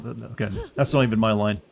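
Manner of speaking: ordinary delivery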